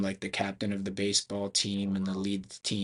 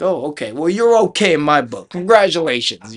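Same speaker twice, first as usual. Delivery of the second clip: high, loud imitated congratulations; hearty approval; 6 semitones higher, 12 dB louder than usual. Banquet guy accepting the Filipino rookie, loud and hearty. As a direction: give a big, loud seal of approval.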